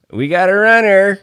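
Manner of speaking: high, loud quote of a pilot's call; pilot's line. Retold or imitated. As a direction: imitate a pilot sounding the alert. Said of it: "you know, he said when".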